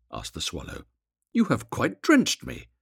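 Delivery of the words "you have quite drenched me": high, slow reproach; peevish complaint. A narrator's reading. Swallow drenched by the weeping prince, peevish reproach.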